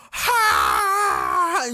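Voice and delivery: a sudden high, loud scream of pain; imitated scream. Retelling the friend being bitten, a high loud imitated scream. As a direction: shriek it like someone being mauled. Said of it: "just like that".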